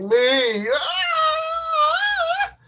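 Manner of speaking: very high, loud yelled "Me" into a cry; climax yell. Retold or imitated. Man answering who's coming, loud high climactic yell. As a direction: let it rip like a climax. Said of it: "I'm like".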